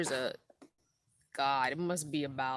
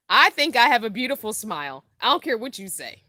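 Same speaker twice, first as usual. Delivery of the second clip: playful defiance; 7 semitones higher, 14 dB louder than usual. Real, not acted. Defiant about her smile.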